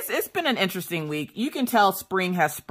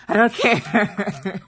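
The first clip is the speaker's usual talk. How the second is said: laughing; 4 semitones higher, much slower than usual. Real, not acted. Laughing drawn out.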